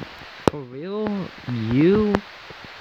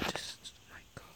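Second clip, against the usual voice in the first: whispered; 26 dB quieter than usual. Real, not acted. Exasperated trailing aside.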